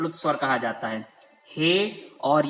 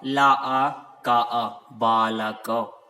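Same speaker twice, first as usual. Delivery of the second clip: syllable by syllable; 4 semitones lower, 3 dB louder, much slower than usual. Shown as a demonstration. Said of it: "teacher spells word syllable by syllable".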